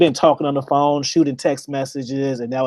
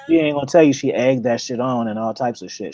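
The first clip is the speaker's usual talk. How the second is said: usual speaking voice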